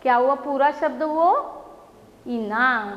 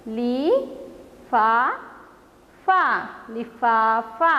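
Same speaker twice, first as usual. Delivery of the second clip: slow drawn-out reading; much slower than usual. Shown as a demonstration. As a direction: stretch लिफाफा out slowly, syllable by syllable. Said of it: "और एक बार पढ़ते हैं". (लिफाफा को बहुत धीरे, खींचकर पढ़ना)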